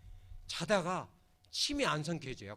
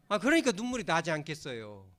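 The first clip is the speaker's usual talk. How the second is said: ordinary delivery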